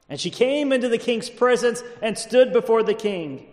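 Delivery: high-pitched pulpit reading; declaimed Bible verse; dramatic scripture reading. A narrator's reading. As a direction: proclaim the verse to the congregation. Reads scripture aloud.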